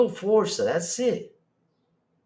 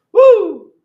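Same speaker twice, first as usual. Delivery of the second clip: loud whoop; 13 semitones higher, 12 dB louder than usual. Real, not acted. Whoops at chat.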